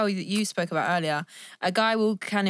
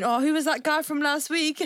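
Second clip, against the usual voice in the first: higher, accusing question; jealous boyfriend; 7 semitones higher than usual. Retold or imitated. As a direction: needle her, jealous and petty. Jealous man acted.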